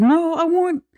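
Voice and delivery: put-on very high, pleading child's voice; little daughter refusing a new bunny; whining little girl. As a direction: beg like a kid who wants her bunny back. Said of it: "Agnes is like".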